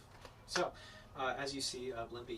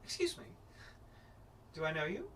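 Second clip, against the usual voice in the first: haughty noblewoman; 3 semitones higher than usual. Acted. GM voices Miss Brandwin.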